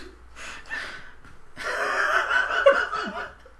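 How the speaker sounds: high, wheezy fit of giggles; helpless laughter. Real, not acted. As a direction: lose it laughing, can't get words out. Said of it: "Sorry, I just".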